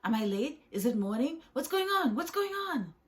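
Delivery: loud, high, flustered questions; climbing into a high, frantic fluster; panicky waking. Acted. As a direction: panic as if you overslept. Sponge wakes confused and panicking.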